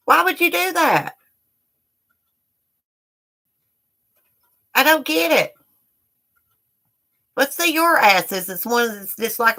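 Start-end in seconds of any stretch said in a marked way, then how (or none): 0.1-5.5 incredulous. Real, not acted. Incredulous at haters. 7.4-8.5 taunting. Real, not acted. Taunting haters.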